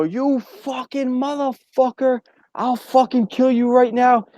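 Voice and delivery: high, slow raging screamed curses; furious yell. Retold or imitated. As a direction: roar threats at the cab driver. Yelling at cab driver.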